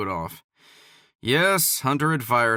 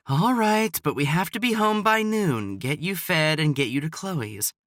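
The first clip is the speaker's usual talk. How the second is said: reluctant mother; 6 semitones higher than usual. A narrator's reading. Mother giving in.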